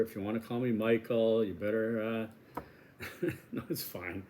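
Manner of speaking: ordinary talk, nothing marked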